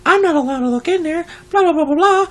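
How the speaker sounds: high whiny mocking voice; mock impression of the coworker. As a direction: mimic her in a high nagging whine. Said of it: "She's like".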